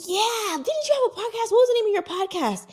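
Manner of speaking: she's sure she knows you, so press the question high and quick; imitating the eager fan. Fan insists about the podcast.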